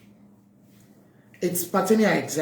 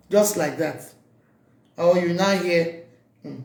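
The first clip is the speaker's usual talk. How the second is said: usual speaking voice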